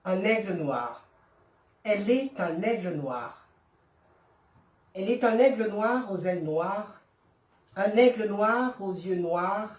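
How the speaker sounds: measured French verse with falling line ends; solemnly paced poetry reading; poem recitation. Acted. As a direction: recite it deliberately, pausing after each phrase.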